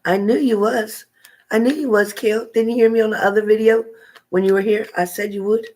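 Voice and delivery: high, sing-song I-told-you-so; playful teasing. Real, not acted. Host ribbing a viewer, high playful told-you-so. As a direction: tease her that you called it.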